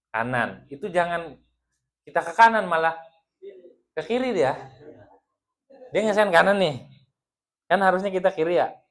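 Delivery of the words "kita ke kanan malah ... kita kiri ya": rising, louder and quicker, animated telling; excited. Acted. Preacher telling the crowd about a wife's signals, excitedly. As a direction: get worked up over the wrong turn. Preacher acts out a traffic mix-up.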